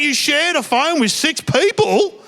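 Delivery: can't believe it; incredulous son. Retold or imitated. Son asking his dad, slow amazement. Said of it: "He's like".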